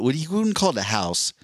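ordinary talk, nothing marked

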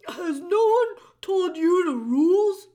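loud, stammering gruff jailer; bellow, tripping over the words; booming jailer voice. A narrator's reading.

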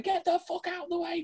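high, far yelled curse; yelling at trucks on the road. Retold or imitated. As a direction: shout it at the trucks in a panic. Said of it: "we were going".

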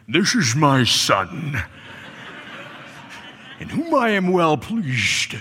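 a hint of a Scottish movie star, very slow; Sean Connery. Preacher imagining the voice from heaven, light Connery impression. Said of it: "maybe the voice sounded a bit like Sean Connery".

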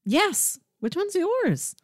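ordinary delivery

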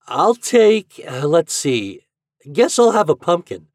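high start, slow pondering middle; hesitant deciding. A narrator's reading. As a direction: ponder aloud, then settle on it. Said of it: "finally decided Hoppy".

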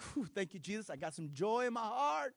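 relieved the line finally moves; mock relief. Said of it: "You're like".

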